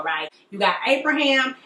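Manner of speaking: ordinary talk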